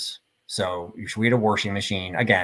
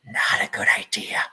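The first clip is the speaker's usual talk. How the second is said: whispered aside; 4 dB quieter than usual. Real, not acted. Wry aside about laughing at mom.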